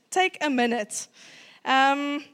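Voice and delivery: ordinary talk, nothing marked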